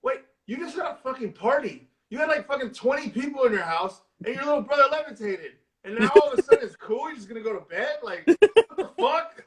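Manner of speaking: high, rising disbelief building to a laugh; incredulous rant. Retold or imitated. Podcaster ranting at the movie sister, high disbelief. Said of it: "It's like".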